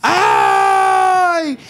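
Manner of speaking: high, slow complaint ending in a loud ouch; pained groan. Real, not acted. Streamer complains his back hurts after the cartwheel, pained cry. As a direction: moan about the sore back, then cry out.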